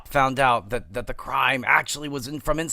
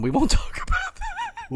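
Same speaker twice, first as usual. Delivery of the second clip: laughing; 4 dB quieter than usual. Real, not acted. Laughing off questionable operas.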